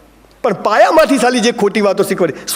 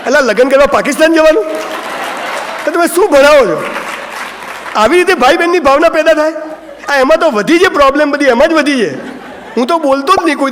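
indignant outburst; 6 semitones higher than usual. Real, not acted. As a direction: raise the voice in amused outrage at the teaching. Mocking school teaching, indignant. (હસતાં હસતાં ઊંચા અવાજે વિરોધ)

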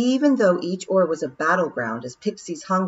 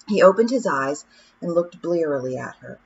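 ordinary delivery, nothing marked

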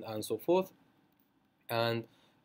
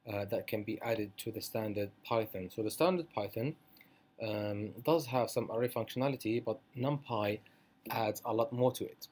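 ordinary talk

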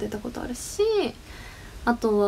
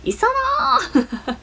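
drawn-out call breaking into laughter; shouted name; 12 semitones higher, 3 dB louder, much slower than usual. Retold or imitated. Streamer imitates the name shout, playful drawn-out yell. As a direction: holler the bare name playfully, then laugh. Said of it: "って叫んだ".

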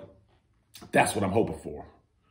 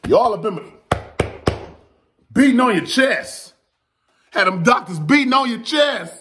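louder, higher, pumped delivery; hyped up; 10 semitones higher, 5 dB louder than usual. Real, not acted. Vlogger hyping the battlers, louder and higher pumped. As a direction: hit it with excitement.

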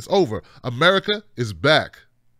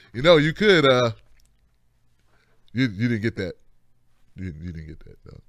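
hesitant nitpicker; 7 semitones lower than usual. Acted. Onlooker to someone working their butt off, hesitant, low nitpicking. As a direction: trail off into a low, hesitant quibble. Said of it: "and they're like".

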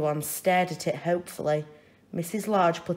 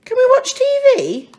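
put-on high, fast child's plea; a kid begging to watch TV; eager child voice; 11 dB louder, faster than usual. Child Lester eagerly asks Mum for TV.

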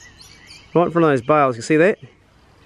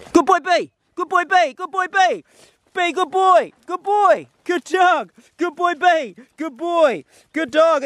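much higher, louder sing-song praise for the dog; 5 dB louder, slower than usual. Real, not acted. Hunter praising his dog for the retrieve, high excited praise voice. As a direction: gush over the dog in a high happy voice.

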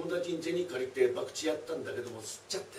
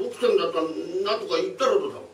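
demanding thief; 3 semitones higher, 6 dB louder than usual. Acted.

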